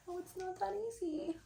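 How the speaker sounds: mock the viewer's excuse; whiny mock excuse. Reader mocking viewers who say it's not easy. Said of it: "And it's like".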